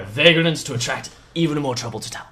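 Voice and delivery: scornful father, loud at the start; indignant, accusing tirade; yelling angry father. Acted. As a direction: berate the newcomers in character. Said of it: "as is yelling".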